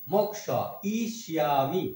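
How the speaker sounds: মোক্ষ … ইষ্যামি broken apart; split pronunciation. Shown as a demonstration. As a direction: break মোক্ষ ইষ্যামি into its parts. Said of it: "শুদ্ধ উচ্চারণ সংস্কৃতের".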